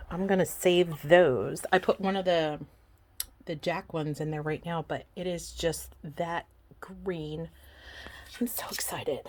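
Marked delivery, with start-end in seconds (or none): 4.9-9.2 whisper. Real, not acted. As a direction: breathe it out in quiet awe. Hushed awe at the green glow.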